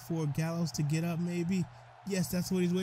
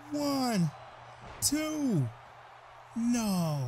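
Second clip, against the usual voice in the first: excited; 7 semitones higher than usual. Real, not acted. Commentator calling the big finisher.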